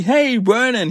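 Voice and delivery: high, bright-pitched call-out; excited hail. Real, not acted. Fan spots a new character at Channel Six, excited hail.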